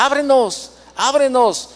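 a hint of drunk men demanding entry; mock yelling. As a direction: call it out like men banging on the door. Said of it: "ellos me ven y decían".

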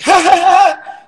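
imitating a booming high laugh; big laugh. [name] approaching the set, a loud imitated laugh. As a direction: belt out a big showy laugh. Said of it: "I just heard this big voice".

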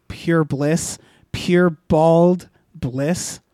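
very slow, drawn-out bliss; savored bliss. Real, not acted. Narrator savoring the blissful feast. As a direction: stretch out the bliss.

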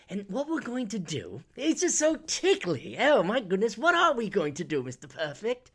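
hammed-up British fretting; mock British accent. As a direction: mimic a precious, fussy Brit. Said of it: "guy with a British accent".

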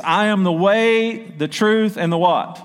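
slow, loud, each phrase held for the crowd; call and response. Shown as a demonstration. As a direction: leave the last word open for them to answer. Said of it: "let's say that together".

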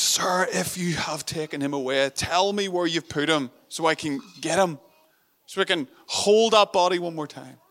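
loud, desperate plea; pleading. Retold or imitated. Mary begging the gardener for the body, pleading loud. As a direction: beg the stranger to give him back. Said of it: "And Mary pleads".

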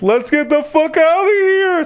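high mock panic; panicked escape. Gorblin and Amber flee the tentacle monster in high mock panic. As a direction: yelp it high like fleeing a monster. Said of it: "Gorblin and Amber are like".

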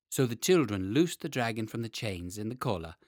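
ordinary delivery, nothing marked

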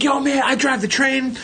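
high, eager fan voice; excited fan. Retold or imitated. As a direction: imitate a fan proudly introducing himself. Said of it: "this guy was a fan".

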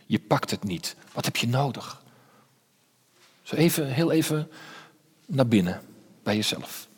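softly spoken meditative questioning; quiet guided reflection. Acted. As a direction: lead them gently into the silence to feel their thirst.

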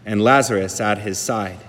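slow, solemn scripture reading; Gospel reading. Acted. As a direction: read it like a lector at Mass.

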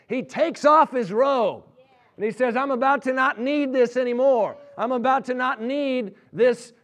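fervent preacher building a story to its peak; impassioned preaching. Real, not acted. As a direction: preach it at full force, driving each phrase home. Preaches story's climax at full force.

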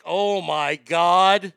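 slow, loud, drawn-out gasp of disbelief; a stunned kid who can't believe his ears; shocked. Retold or imitated. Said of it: "I was like".

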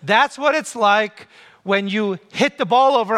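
normal talking voice

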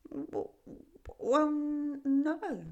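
stammering small frog; timid frog. A narrator's reading. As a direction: stutter a shy no. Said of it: "it replied".

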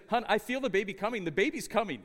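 wife urging her husband in the car, loud and urgent. Retold or imitated. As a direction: press him that the baby is coming now. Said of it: "She's like".

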